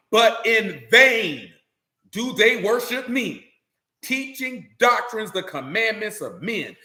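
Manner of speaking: slow, weighty scripture emphasis; emphatic verse reading. A narrator's reading. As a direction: stretch each word of the verse out slowly. Preacher hammers the key verse.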